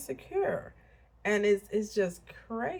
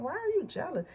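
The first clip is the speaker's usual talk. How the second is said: incredulous question; 11 semitones higher than usual. Retold or imitated. Retelling her questioning of jealous friend.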